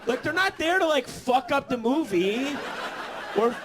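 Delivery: high, loud comic indignation; hammed-up, high-pitched outrage; outraged rant. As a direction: plead your case at an exasperated yell. Acted rant at the roommate.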